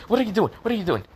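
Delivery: high, urgent repeated question; flustered protest. A narrator's reading. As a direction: blurt it twice in a high-pitched fluster. She protests as hair is grabbed.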